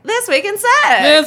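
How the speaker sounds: high, slow show-title announcement; announcer voice. Acted.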